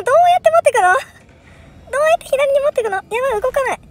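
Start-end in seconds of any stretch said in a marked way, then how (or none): 0.0-2.7 exasperated. Real, not acted. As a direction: plead with the puzzle. Exasperated question, puzzle stuck.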